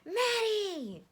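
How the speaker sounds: high, loud joyful call of a name; excited shout. Acted. Teen spotting his brother, calling loud and excited. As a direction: call out across the crowd.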